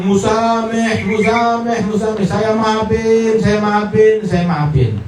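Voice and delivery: high, drawn-out repeated call; calling out forgiveness. Retold or imitated. Man calls out forgiveness on reaching home, high drawn-out calling. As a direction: cry it out on a sustained high note.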